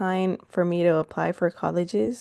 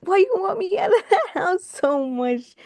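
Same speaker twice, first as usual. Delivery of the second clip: high-pitched, laughing indignation; playful wounded disbelief with a laugh; mock-hurt protest; 9 semitones higher than usual. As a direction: retell a baffled complaint to mom, pitched up. Acted protest to her eager mom.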